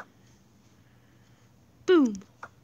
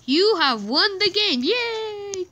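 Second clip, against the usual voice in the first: proud winner; 7 semitones higher, 4 dB louder, much slower than usual. Real, not acted. Announces her win in game.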